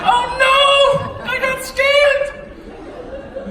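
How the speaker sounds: very high, loud, drawn-out mock wail; hammed-up horror. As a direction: wail it slowly in exaggerated dismay. Repeats the realization, more exaggerated.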